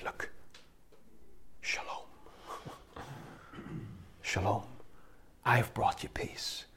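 soft whispered greeting with a small laugh; hushed and tender, almost a whisper; gentle whisper. Acted. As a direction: whisper it like calming frightened friends. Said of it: "act it out".

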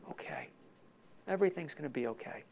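whispered, then quick soft reassurance; calm yourself in a hush; soothing whisper. Retold or imitated. Inner calming after turbulence.

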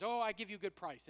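imitating a taxi driver's broken English accent; lightly voiced Taiwanese driver with broken English accent. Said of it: "in his broken English".

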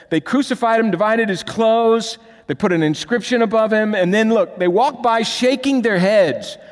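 loud, high preaching voice; preaching at full force. Acted. Preaching the mockers' scene.

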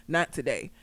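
low, soft retold straight reply; deadpan. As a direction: quote him quietly, without emotion. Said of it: "said it with such a straight ass face".

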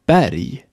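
Berg in Swedish, with a wide open E; very open E before R. Shown as a demonstration. Teacher models Berg for learners, very open E. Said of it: "Noch offener wird das E".

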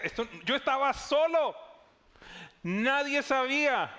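as if someone saw his secret; astonished disbelief. Acted.

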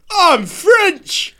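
loud, high-pitched hammed-up French accent; bad French accent. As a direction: belt out a terrible mock French accent. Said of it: "but it's shit if I'm like".

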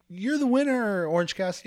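high-pitched mock verdict; acted announcement. Everyone declaring Cassidy the debate winner, mock announcement. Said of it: "they're gonna be like".

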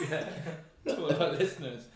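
laughing through the word; giggling helplessly. Real, not acted. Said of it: "Mark's having a hysterical breakdown".

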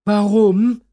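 put-on high, loud outraged child's why; indignant little mermaid. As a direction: protest like a cheeky little girl. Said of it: "fragt die kleine Nixe empört".

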